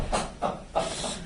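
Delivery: real burst of laughing; wordless laughing fit; laughter. Real, not acted. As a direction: laugh at the joke just made. Laughing at the joke just made.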